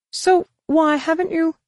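very high, quick alien voice; Droma's retort. Acted. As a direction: snap back in a squeaky alien voice.

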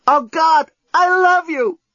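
high, impassioned cry; passionate outburst. A narrator's reading. Lucy declaring love facing imminent destruction, passionate outburst. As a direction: blurt out love at full pitch.

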